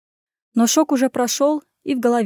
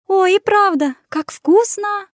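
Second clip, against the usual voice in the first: much higher talk with full mouth; 9 semitones higher, 3 dB louder, slower than usual. Acted. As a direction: say it through a mouthful. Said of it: "пробормотала брюнетка с набитым ртом".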